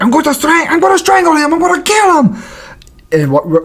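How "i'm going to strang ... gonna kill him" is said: very high-pitched mock threat; hammed-up frantic fighter impression; shouty impersonation, pitched way up; Hamza. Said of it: "I remember Hamza's like".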